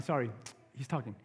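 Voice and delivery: ordinary talk, nothing marked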